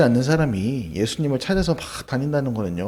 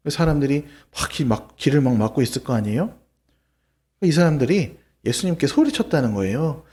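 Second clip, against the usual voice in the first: ordinary delivery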